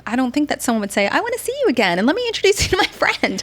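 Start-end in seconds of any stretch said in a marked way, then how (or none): 1.1-3.4 high, quick, gushing quote; mock eagerness. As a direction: pretend to be an eager new acquaintance. Imagined eager new acquaintance, quoted.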